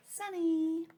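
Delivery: bright high excited call. Acted. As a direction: sing out the word happily. Teacher announcing favorite weather.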